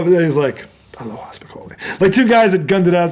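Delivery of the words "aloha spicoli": whisper. Retold or imitated. Teacher's parting line retold again.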